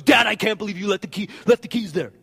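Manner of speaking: put-on the kid who wrecked it pointing at dad; blaming teenager. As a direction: teenager who crashed the car blaming his father, loud and indignant. Teen blames dad for wreck.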